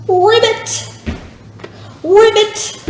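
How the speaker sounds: high, playful ribbits; frog ribbit. Shown as a demonstration. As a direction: croak like a hopping frog. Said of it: "and then ribbit as we come back".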